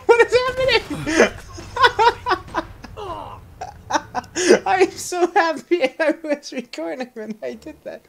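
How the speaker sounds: very high, slow words squeezed out through laughter; laughing hysterically. Real, not acted. Gamer delighted the accident was recorded, hysterical laughter. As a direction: squeal helplessly while laughing. Helpless laughter at game mishap.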